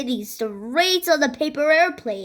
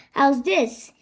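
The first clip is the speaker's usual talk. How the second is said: usual speaking voice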